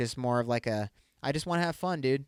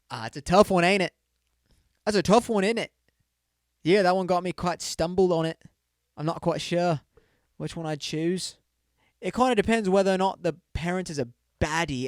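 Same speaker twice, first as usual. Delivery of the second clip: put-on playful British accent, louder and higher; 4 semitones higher, 4 dB louder than usual. Podcaster answering a listener poll in a playful British accent. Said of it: "as the Americans would say".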